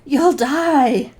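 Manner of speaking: wheezy breathless plea, slow; wheezing. Retold or imitated. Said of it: "I wheeze".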